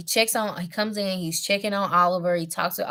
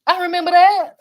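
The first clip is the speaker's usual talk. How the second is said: high, loud mock amazement; amazed whoa; 11 semitones higher, 11 dB louder than usual. King Kane amazed at Oliver's body, high loud whoa. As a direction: gasp it out, higher and louder, as if impressed. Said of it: "he was like".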